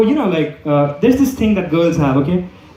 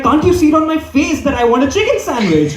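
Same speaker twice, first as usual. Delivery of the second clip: indignant demand; 7 semitones higher than usual. Retold or imitated. Girlfriend snaps at the sandwich guy.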